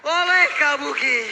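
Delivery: loud, high hype call; esports caster. Real, not acted. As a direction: shout the play as it happens. Caster calls a Lord steal.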